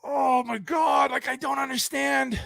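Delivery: high, strained, drawn-out wail; exasperated despair. Real, not acted. Reviewer who cannot understand the script, despairing. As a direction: wail it out, baffled and at wits' end.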